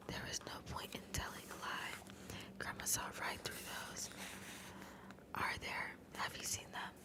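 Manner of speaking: hushed, voiceless ASMR narration; whispered story reading. Acted. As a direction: read the story entirely in a whisper.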